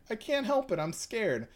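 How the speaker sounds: put-on high, frightened boy voice; scared Koichi. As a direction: squeak it out in fear.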